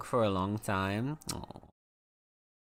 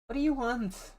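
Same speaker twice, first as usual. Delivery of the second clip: cat-talk voice; 10 semitones higher, faster than usual. Acted. Talking to her cat.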